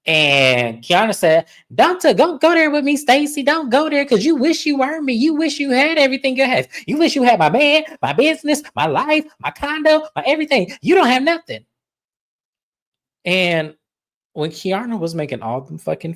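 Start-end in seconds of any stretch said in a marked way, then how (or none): 1.7-11.6 very high-pitched imitation of a rant; mock indignant tirade. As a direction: mimic a worked-up quarrel, pitch pinned way up, listing each item. Acting out Kiana's tirade at Stacy.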